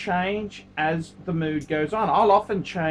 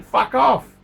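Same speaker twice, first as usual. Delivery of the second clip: louder, higher snapped dismissal; angry retort; 5 semitones higher, 6 dB louder than usual. Retold or imitated. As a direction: cut the buyer off. Quoting his angry reply.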